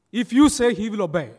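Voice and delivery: loud, high opening, easing down; insistent urging. Retold or imitated. Villagers urging the priest to come, insistent. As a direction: press the point forcefully.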